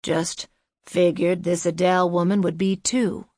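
put-on lightly hoarse old man. A narrator's reading. Old man excusing his slip about Adele, hoarse. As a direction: keep a hoarse edge while deflecting. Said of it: "he said hoarsely".

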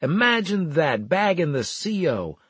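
high, loud teasing. A narrator's reading. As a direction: crow about it for others to hear. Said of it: "I said loudly".

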